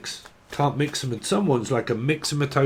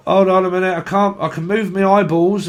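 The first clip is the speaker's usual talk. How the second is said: urgent call; 6 semitones higher, 6 dB louder than usual. Retold or imitated. Patient in cast voiced.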